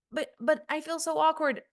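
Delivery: high-pitched, stammered mock protest; awkward objector. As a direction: imitate a self-conscious student objecting. Voices an awkward student's objection.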